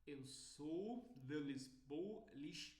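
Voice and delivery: shaky attempt at a European Portuguese accent, slow. Shown as a demonstration. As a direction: try a Lisbon accent and falter. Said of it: "eu não consigo imitar bem".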